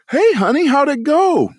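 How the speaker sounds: high, bright-voiced mom greeting; cheerful mom. Mom greeting her son home from practice, high and cheery. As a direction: sing out a cheery hello like mom.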